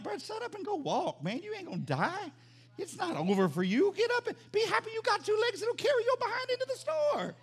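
high-pitched, exasperated mock rant; mock scolding. Preacher telling a complainer to go walk, high-pitched mock scolding. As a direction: scold a whiner, voice climbing way up.

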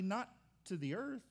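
ordinary delivery

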